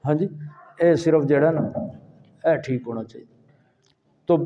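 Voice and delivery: ordinary talk, nothing marked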